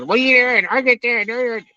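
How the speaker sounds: halb gespielter Ruf, deutlich höher; mild mock yell. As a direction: ruf es höher wie ein genervter Kumpel. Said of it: "you're gonna hear this".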